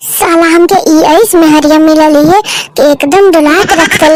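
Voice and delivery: low, muttered village husband; grumbling husband. Acted. Husband grumbles about his wife. (धीमी भारी आवाज़ में बड़बड़ाता पति)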